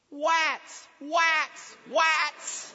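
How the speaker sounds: put-on little kid's high, loud echo yell; Erin. Kid in the car seat echoing dad, high mimicking shout. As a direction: mimic a small child parroting a yell, R turned to W. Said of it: "from the back seat I heard".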